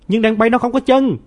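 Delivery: put-on furious, high, loud child's yell; angry shout. A narrator's reading. Nam angrily yells that flying birds have no legs, a high loud shout. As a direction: shout it in anger. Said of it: "Nam tức giận hét lên".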